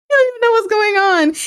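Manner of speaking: high, laughing teasing remark; amused disbelief. Real, not acted. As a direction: tease him through a laugh. Laughing at the clueless general.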